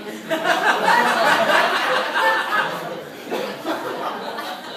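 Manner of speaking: high breathy laughter; long laugh. Real, not acted. Laughing after the car window line. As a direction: crack up at the punchline.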